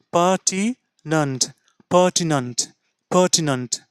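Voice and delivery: pertinent ending "nunt", slow first take, falling; teacher drilling pertinent; pronunciation demo. Shown as a demonstration. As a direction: say pertinent with the last syllable as নান্ট. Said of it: "নেন্ট না, নান্ট".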